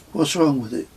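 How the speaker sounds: a hint of a puzzled child; son's voice. A narrator's reading. Son questioning dad about the arrow, lightly voiced boy.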